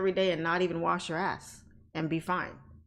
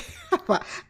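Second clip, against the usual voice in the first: short high laugh; 12 semitones higher, 3 dB louder than usual. Real, not acted. Laughing at chat.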